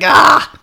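mock-grumpy cry of protest; grumpy groan. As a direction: imitate a grump's exasperated outburst. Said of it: "He's just all grumpy".

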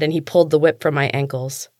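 ordinary delivery, nothing marked

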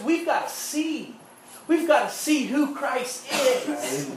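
high, ringing preacher's voice at full force; preaching. Acted. Pastor preaching forgiveness to his church, fervent. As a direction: preach it with conviction. Preaching on Christ not condemning.

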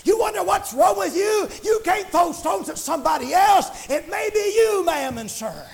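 high, driving revival cadence; fervent preaching. Real, not acted. Preacher urging people to find an altar, fervent preaching. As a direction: preach urgently, stretching the key words.

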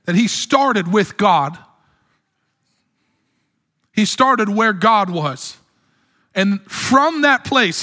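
slow, weighty, every word placed; slow deliberate preaching. Acted. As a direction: spell it out slowly for the congregation. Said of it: "slow emphatic preaching".

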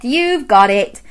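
usual speaking voice

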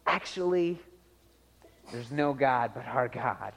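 quiet, low, hesitant correction; soft, tentative contradiction against the crowd; understated Elijah objection. Acted. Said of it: "here's Elijah going".

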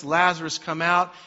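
calling out loudly into a tomb; Jesus calling Lazarus out in a loud voice. A narrator's reading. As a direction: summon the dead man loudly. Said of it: "Jesus called in a loud voice".

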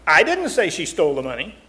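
emphatic I, the rest left lighter; stress on I. Shown as a demonstration. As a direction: hit the word I hard, as if someone else said it. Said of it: "the emphasis you place on it".

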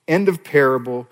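normal talking voice

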